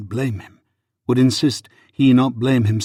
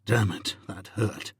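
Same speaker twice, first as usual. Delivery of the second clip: pained undertone; 8 dB quieter than usual. Acted. Husband stung by her words.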